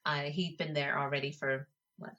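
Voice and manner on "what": ordinary talk, nothing marked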